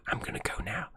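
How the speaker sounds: soft breathy whisper; signing off the stream in a whisper. Real, not acted. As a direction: whisper it to yourself. Murmuring aside while leaving.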